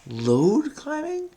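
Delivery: high, slow doubting question; can that really be it?; incredulous. Real, not acted. Puzzling over a crossword answer, incredulous.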